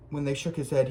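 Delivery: ordinary delivery, nothing marked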